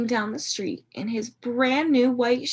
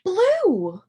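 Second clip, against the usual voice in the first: high, loud "Blue!"; bright storybook cheer; excited reveal; 7 semitones higher, 5 dB louder than usual. A narrator's reading. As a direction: announce it with glee. Revealing shoe color to kids.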